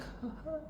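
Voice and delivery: a tiny high breathy squeak, almost no sound; voiceless penguin squeak. Acted. Acting out Wheezy the penguin with no voice, tiny squeak. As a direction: squeak like a toy with a broken voice box. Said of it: "penguin boy doesn't have a voice".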